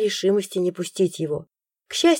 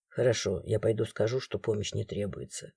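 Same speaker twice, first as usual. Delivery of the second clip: much softer, lower, fading resigned voice; sighing surrender; 9 semitones lower, 9 dB quieter than usual. A narrator's reading. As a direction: give in with a tired sigh. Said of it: "Со вздохом сдался Крис".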